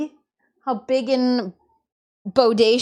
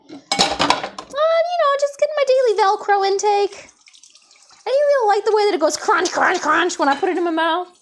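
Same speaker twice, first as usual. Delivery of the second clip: pet's voice; 8 semitones higher, 3 dB louder than usual. Retold or imitated. Turtle telling owner about eating Velcro, high silly voice. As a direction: voice the turtle explaining his snack. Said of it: "That's what I feel like he says".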